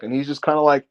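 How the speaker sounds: ordinary talk, nothing marked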